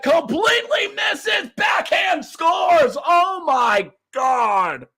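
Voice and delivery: rising shout of disbelief; excited shout. Real, not acted. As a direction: yell it as the puck goes in. Shootout goal, live commentary.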